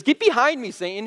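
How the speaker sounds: a high, sharp mock rebuke. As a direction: snap it at Peter like Jesus. Jesus rebuking Peter, retold.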